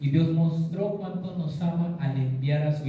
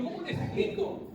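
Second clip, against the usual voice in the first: helpless reply; 12 semitones higher, 5 dB quieter than usual. Retold or imitated. Acting his reply to wife.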